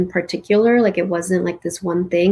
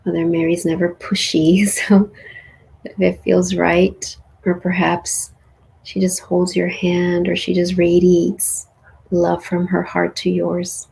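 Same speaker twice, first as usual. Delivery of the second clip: calm meditation guide closing the journey; guided meditation. Acted. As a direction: guide them gently through the closing.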